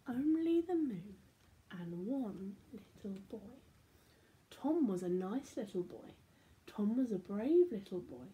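put-on lively read-aloud for children; animated storybook reading; story narration. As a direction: tell it like a bedtime picture book. Reads picture book aloud.

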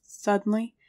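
usual speaking voice

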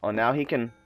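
ordinary delivery

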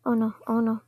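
ordinary talk

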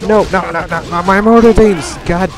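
frustrated gamer outburst; frantic shout. Real, not acted. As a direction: yell in alarm as your base is attacked. Units under attack in game.

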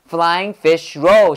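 "Flying fish roll" slow, a nod's beat on each word; three stresses. Shown as a demonstration. Teacher asking for three nods, three clear stresses. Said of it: "고개를 세 번 움직여야 돼".

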